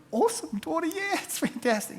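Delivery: high, gushing praise on the phone; gushing enthusiasm. Retold or imitated. Pastor retelling his gushing praise to a caller, high-pitched. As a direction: pour on the cheerful praise.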